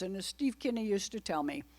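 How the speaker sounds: ordinary delivery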